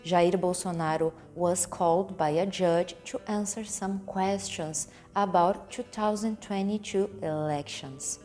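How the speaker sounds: usual speaking voice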